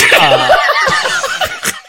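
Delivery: loud breaking laugh; a high giggle bursting out; high burst of laughter. Real, not acted. Host losing it again mid-story, high laughter. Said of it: "We're getting back on track".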